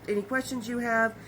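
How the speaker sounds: ordinary delivery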